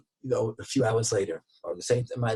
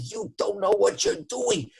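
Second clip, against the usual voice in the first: much higher, slow and pointed; angry yell; 4 dB louder, slower than usual. Retold or imitated. Customer yelling at the clerk, slow and furious. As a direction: spit the accusation out word by word. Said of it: "And he starts yelling at him".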